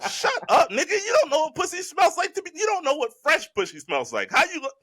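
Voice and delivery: high-pitched, exasperated tirade; heated comic scolding; worked up. Real, not acted. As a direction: snap back in indignant disbelief, voice way up. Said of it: "got a little worked up there".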